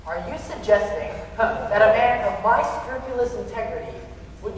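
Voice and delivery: loud, affronted tirade; pompous indignation. Acted. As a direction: bristle with wounded pride. Critic indignantly defends himself.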